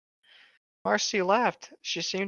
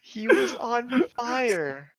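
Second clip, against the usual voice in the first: slow, higher exasperated repeat; drawn-out insistence; 7 semitones higher, much slower than usual. Acted. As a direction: stretch it out, exasperated.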